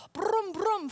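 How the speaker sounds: loud mock engine rumble; motorcycle engine imitation. Telling the dream story to the class, mock motorcycle roar. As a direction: rev it like a big loud cycle.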